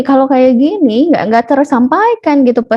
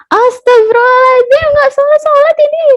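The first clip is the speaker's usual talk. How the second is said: exasperated mother; 3 dB louder than usual. Acted. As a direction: act a mother at the end of her patience. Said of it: "nada yang lebih tinggi lagi". (omelan ibu kesal, melengking)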